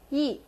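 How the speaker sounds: "yi" short and sharply falling; sharp fourth tone. Shown as a demonstration. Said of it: "And the fourth one".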